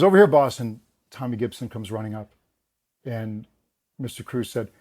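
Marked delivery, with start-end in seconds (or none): 0.0-0.6 loud, higher call across the set; key grip calling out to the star. Retold or imitated. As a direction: shout it back to the boss. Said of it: "He goes".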